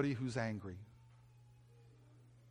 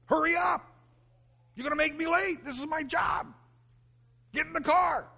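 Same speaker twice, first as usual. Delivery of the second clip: high-pitched frantic parent's shout; yelling at kids; 14 semitones higher, 4 dB louder than usual. Retold or imitated. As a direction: yell it like a late, exasperated father. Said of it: "So I was yelling at them".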